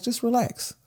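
high soothing put-on voice of his wife; impression of his wife. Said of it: "that was the impression of me".

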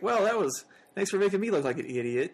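high, annoyed and laughing; sarcastic. Retold or imitated. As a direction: complain with mock exasperation. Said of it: "and she's like".

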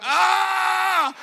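high, drawn-out mock scream; long acted "ah" of exasperation; frustrated scream. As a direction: let out an overwhelmed comic yell. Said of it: "I'm like".

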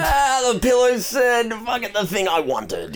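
high, slow, drawn-out hammed-up cry; mock delayed announcement. Mocking someone announcing after the flip, high and slow. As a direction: stretch it out high with fake revelation. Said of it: "pause for a minute and go".

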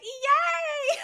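very high, loud squealed cheer; mock gleeful cheer. Podcaster voices girls holding the frog, squealed mock cheer. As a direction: imitate delighted little kids cheering.

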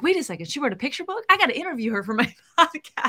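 quick, excited retelling; eager self-quote. Retold or imitated. Host retelling finding the author's book, eager and quick. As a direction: rush through a sudden bright idea, laughing at the end. Acts out her eager reaction.

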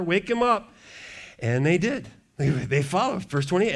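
ordinary talk

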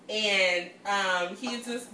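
normal talking voice

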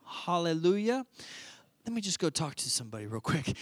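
low, soft, feigned enthusiasm; tactful. Acted. Herod stalling the three wise men, tactful. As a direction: cover the panic with muted, polite agreement. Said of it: "he does it tactfully".

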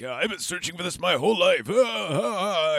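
high-pitched, hammed-up elation; overjoyed Van Helsing. Vampire hunter thrilled by his lifelong search, mock ecstatic. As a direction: crow with mock joy, gasping out the ahs. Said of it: "he's all happy and shit".

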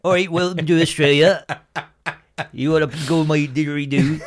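mock Australian; mock Australian accent. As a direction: lay on a thick Aussie twang, lightly. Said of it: "I turned into fucking B F G".

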